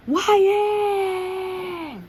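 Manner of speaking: long, high-pitched bellowed name; teacher's yell. A narrator's reading. Teacher calling after a pupil walking out, long high yell. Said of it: "The teacher yells".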